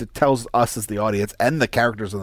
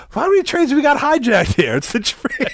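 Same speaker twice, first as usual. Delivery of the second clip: higher, incredulous quote; mock exasperated; 13 semitones higher than usual. Daniels snapping back about the train, higher and incredulous. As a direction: act fed up with the question.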